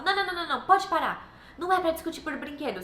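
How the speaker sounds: high, loud "no, no, no" telling-off; stern scolding. Retold or imitated. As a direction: reprimand the children firmly and fast. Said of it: "já tinha essa reação".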